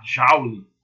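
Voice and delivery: JOULE as "JAU-lee", the A leaned on loudly; almost an A. Shown as a demonstration. Teacher demonstrates the sound to class, joule with a loud A. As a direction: hit the open A in JOULE, "ZHAU-lee". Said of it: "Quase um A".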